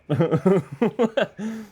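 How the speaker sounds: loud high-pitched cackle; loud laugh. Real, not acted. Laughing at game reveal.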